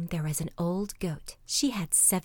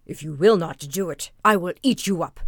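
threatening wolf; 2 semitones lower, 7 dB louder than usual. Acted.

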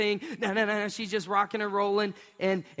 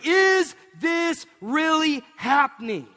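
awestruck disbelief; 7 semitones higher, 5 dB louder, slower than usual. Retold or imitated. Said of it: "They're like".

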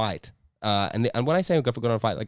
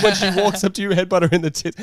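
laughing through the quote; 8 semitones higher, 7 dB louder than usual. Retold or imitated. Laughing while retelling dad's advice.

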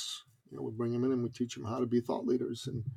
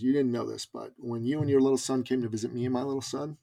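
ordinary talk

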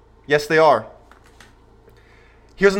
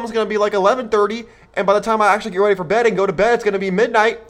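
rapid calculating; 4 semitones higher, faster than usual. Retold or imitated. Son rushing through time math.

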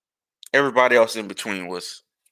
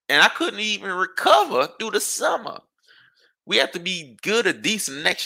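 exasperated rant; 8 semitones higher than usual. Real, not acted. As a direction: relive the shame of that season. Ranting about embarrassing school year.

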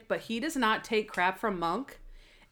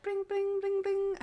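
high sing-song bring bring, much higher; bell ringing imitation; 10 semitones higher, 4 dB quieter than usual. Garbage man ringing the found bell, high sing-song. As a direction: chime it out like a bike bell. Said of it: "he's just like".

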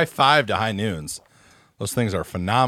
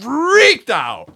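dramatic exclaim; 8 dB louder than usual. Real, not acted. Retelling mom's explosion.

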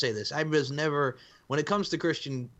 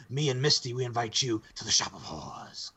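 lightly voiced promo invitation; a hint of a campy promo voice; mock wrestling promo. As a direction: imitate a wrestler's hokey promo delivery. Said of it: "And it sounded like that".

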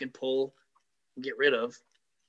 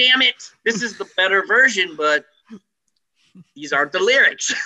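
exasperated; 6 dB louder than usual. Retold or imitated. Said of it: "And I'm like".

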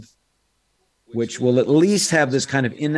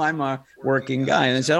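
ordinary talk, nothing marked

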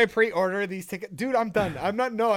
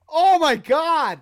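loud, slow cry of delight; excited shout; 9 semitones higher, 11 dB louder, slower than usual. Real, not acted. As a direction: bellow it out, overjoyed. Overcome by laughter.

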